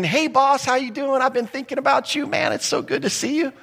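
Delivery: high, gushing suck-up to the boss; butter up the boss with fake cheer; fawning flattery.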